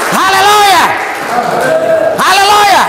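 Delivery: loud, high rallying cry; hallelujah shout. Real, not acted. Preacher urges congregation to praise Jesus, loud high hallelujah shout. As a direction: shout it out to raise the crowd.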